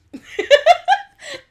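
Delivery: high shrieking laugh. Real, not acted. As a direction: burst out laughing. Laughing at memory.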